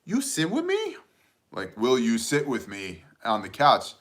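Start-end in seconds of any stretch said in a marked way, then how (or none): 0.0-1.0 put-on very high-pitched little toddler voice; imitating toddler daughter. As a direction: ask it like a tiny child, squeaky high. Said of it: "and she goes".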